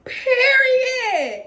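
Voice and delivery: shrieked high and loud through tears; tearful shout. Real, not acted. Vlogger reacting to her new camera, tearful shriek. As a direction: cry it out in pure joy.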